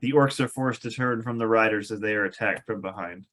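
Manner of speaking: ordinary delivery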